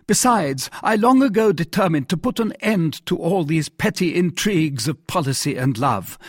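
high-pitched, accusing monarch; indignant king. Acted. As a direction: press the accusation against the queen.

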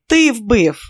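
loud, high accusing shout; furious yell. Acted. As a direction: hurl it at the doctor. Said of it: "заволав Тоні".

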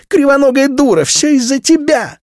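put-on very high, loud child screaming; screaming girl. A narrator's reading. A slapped girl screams insults at her mother. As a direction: shriek it like a furious little girl.

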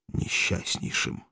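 slow, breathless whisper of несчастнейшим; whispered despair. Acted. Spartacus tells Valeria he will be miserable, whispered. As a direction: breathe несчастнейшим out slowly, almost voiceless. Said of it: "дрожащим голосом".

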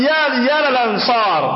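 high, drawn-out mock summons; calling out. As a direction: imitate a man calling his clan to help. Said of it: "dia panggil".

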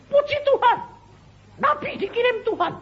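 very high-pitched cry of acclaim; mock-awed high shout; acted exclamation of praise. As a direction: hail a prophet in a high, excited voice. Acts out prisoner hailing a prophet.

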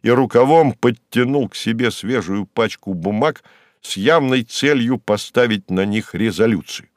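ordinary delivery

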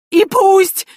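high, loud desperate howl. Acted. Priest hanging upside down, high loud howl. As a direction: wail it out defiantly. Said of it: "взвыл тот".

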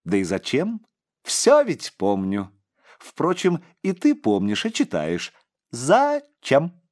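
lightly voiced eerie boy; a hint of a bell-like child; crystal child voice. A narrator's reading. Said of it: "хрустальным голоском произнёс мальчик". (лёгкий хрустальный голосок)

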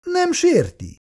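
put-on wife taken aback by the old man's reply; surprised. A narrator's reading. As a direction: caught off guard, repeat it in disbelief. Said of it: "lepődött meg".

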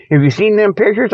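ordinary delivery

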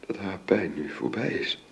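words fading in grief; grieving whisper. Acted. Woman tells her friend of the death, voice fading to a whisper. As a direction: voice dropping to a whisper.

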